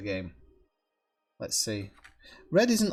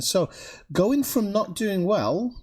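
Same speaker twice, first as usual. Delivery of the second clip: ordinary talk, nothing marked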